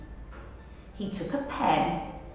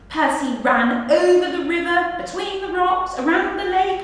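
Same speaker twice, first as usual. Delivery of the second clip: building; 9 semitones higher, 6 dB louder, faster than usual. A narrator's reading. Boy racing back, rising excitement.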